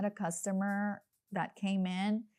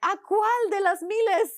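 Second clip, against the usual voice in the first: joking; 12 semitones higher, 7 dB louder, slower than usual. Acted. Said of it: "crack a joke and be like".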